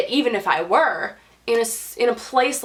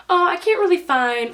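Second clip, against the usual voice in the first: higher-pitched mock nurse quote; lightly put-on higher voice for the nurse; retold nurse; 9 semitones higher than usual. Retelling the nurse's words.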